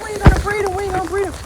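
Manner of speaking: high, loud whiny fan voice; nagging fan asking over and over; mock impatient fans. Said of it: "a lot of people been like".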